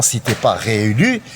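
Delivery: ordinary talk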